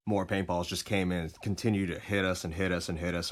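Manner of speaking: ordinary delivery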